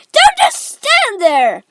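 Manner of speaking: high, slow, loud scream; Missus Gloop. A narrator's reading. Mother screaming at her husband to act. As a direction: scream an order, stretching it out. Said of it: "Missus Gloop screamed at Mister Gloop".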